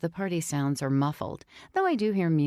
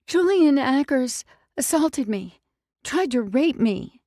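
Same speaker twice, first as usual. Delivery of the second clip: crying confession; 8 semitones higher, 7 dB louder than usual. Acted.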